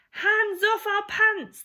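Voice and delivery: loud, high furious dinosaur roar; angry dinosaurs roaring at aliens. Acted. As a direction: bellow it to guard the pants. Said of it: "they roared".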